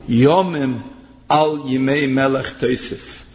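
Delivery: ordinary talk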